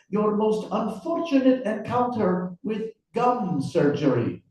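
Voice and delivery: high-pitched, rough Russian accent attempt. Interrogator quizzing his writer guest, in a Russian accent. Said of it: "I really enjoyed your Russian accent".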